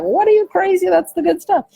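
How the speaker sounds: high, loud playful scoff at her son; mock outraged disbelief. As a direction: act shocked anyone could dislike it, all in fun. Mother teasing son about whipped cream.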